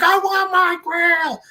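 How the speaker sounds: high, loud, drawn-out yell; demanding king's tantrum. Retold or imitated. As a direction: imitate a king throwing a fit. King demands his grail.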